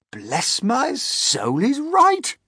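slow start rising to a high shout; astonished old grasshopper; exclaiming. A narrator's reading. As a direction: exclaim in shock, climbing high and loud. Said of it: "the old green grasshopper exclaimed".

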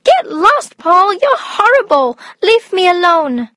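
put-on very high, upset girl's voice; angry teenage sister. A narrator's reading. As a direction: tell your brother off in a high girlish voice. Julie screams at brother who scared her.

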